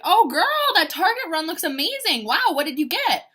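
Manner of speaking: sugary teasing call-out; fake-sweet call-out. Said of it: "I'm like".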